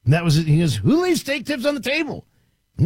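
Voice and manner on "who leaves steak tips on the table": put-on Southern accent. Retelling the dynamic trainer's line, Southern accent. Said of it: "bit of a Southern accent".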